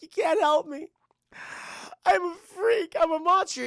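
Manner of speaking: high, loud mock crying; imitated tearful breakdown; anguished outburst. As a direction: sob it out in a hammed-up wail. Girl breaks down, calls herself monster.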